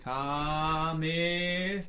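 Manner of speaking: soft, drawn-out call rising in pitch; mock anime attack call. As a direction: imitate a long attack chant, held back. Kamehameha-Angriffsruf aus Spaß nachgeahmt. (gespielter, langgezogener Anime-Kampfruf)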